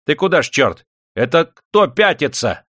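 high, loud challenge to a retreating fighter; heated shouting. A narrator's reading. Master yells at the retreating bodyguard, heated shouting. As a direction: yell with a gambler's heat. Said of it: "азартно закричал хозяин".

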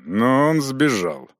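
usual speaking voice

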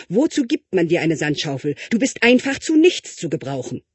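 put-on mother yanks child home, scolding loudly. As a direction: tell the child off as a fed-up mother.

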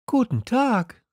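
put-on very high young greeting; high, light hello; Little Prince. A narrator's reading. Prince greets the merchant, in a high voice. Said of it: "sagte der kleine Prinz".

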